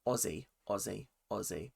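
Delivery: low, soft Australian warm-up chant; quiet practice run in Australian; Australian accent. Shown as a demonstration. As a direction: murmur it to find an Australian accent. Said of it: "let me get into my Australian accent".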